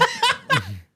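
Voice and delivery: high laugh bursting out; burst of laughter. Real, not acted. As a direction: laugh out loud. Loud laugh.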